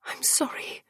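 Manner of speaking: very soft, helpless apology; anguished whisper. A narrator's reading. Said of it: "she whispered, anguished".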